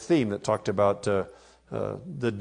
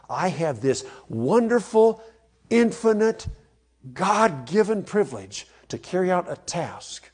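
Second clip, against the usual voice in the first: soaring praise; 7 semitones higher, 3 dB louder than usual. Real, not acted. Preacher exalting his calling.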